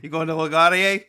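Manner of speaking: put-on loud New York cabbie accent. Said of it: "my entire New York accent right there".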